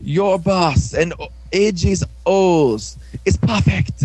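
mock-creepy gushing; creepy boss. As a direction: draw out each phrase with put-on relish. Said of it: "like, really creepy".

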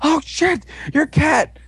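high, slow excited gasp of surprise; fanboy losing it; mock freak-out. Guy flips out, retold.

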